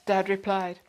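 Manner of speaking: usual speaking voice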